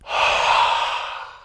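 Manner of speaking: long open-mouth breathy exhale; steamy exhale through a wide mouth; ocean breath. Shown as a demonstration. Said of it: "exhale through ocean breath".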